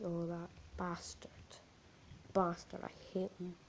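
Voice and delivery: evil toy whispering its hatred; hateful whisper. Retold or imitated. Woody hissing hatred in story.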